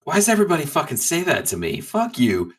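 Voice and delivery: normal talking voice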